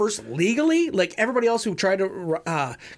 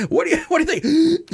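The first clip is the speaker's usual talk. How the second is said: higher, louder eager reporter; mock reporter; 11 semitones higher, 6 dB louder, faster than usual. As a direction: shove the mic in and ask.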